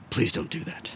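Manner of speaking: whispered, drained and flat; hushed, pained Shatner refusal; weary near-whisper. Retold or imitated. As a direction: breathe it out like you're exhausted by the idea. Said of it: "And Shatner goes".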